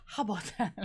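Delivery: imitating Audra's catchphrase delivery. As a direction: echo her signature line. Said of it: "Just the way she says it".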